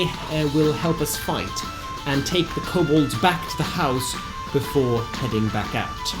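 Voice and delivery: ordinary talk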